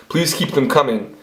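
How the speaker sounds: ordinary talk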